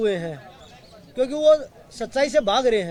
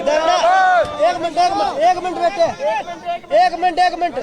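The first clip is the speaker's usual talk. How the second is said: raised, high-pitched call over a crowd; calling for attention; 5 semitones higher, 4 dB louder than usual. Real, not acted. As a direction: call out urgently to hold everyone back. (भीड़ को रोकने के लिए ऊँची आवाज़ में पुकार)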